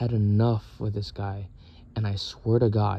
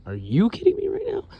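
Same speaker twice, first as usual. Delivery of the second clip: much higher, disbelieving repeat; incredulous; 12 semitones higher than usual. Retold or imitated. Confronting the roommate who spilled the soy sauce, high disbelief. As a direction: shoot the line way up in disbelief. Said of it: "I'm like".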